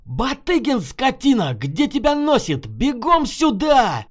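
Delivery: high, angry holler across a distance; yelling with all his might; full-force yell. A narrator's reading. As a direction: bellow for him through cupped hands. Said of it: "заорал, что было сил".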